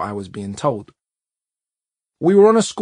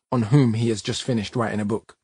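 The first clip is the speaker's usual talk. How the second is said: ordinary delivery, nothing marked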